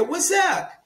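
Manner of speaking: "What's up" tossed off high as a greeting. Shown as a demonstration. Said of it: "a maneira de pronunciar não seria".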